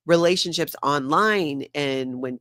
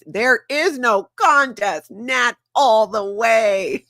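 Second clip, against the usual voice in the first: loud, slow, high victory proclamation; triumphant; 6 semitones higher, 6 dB louder, slower than usual. Retold or imitated. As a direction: draw out each word, high and loud. Triumphantly reading a fan's vote.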